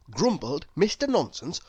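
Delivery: plain reading voice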